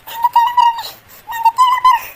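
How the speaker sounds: loud hurt storming off; angry departure. Acted. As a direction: announce leaving in a huff. Storming out of the house.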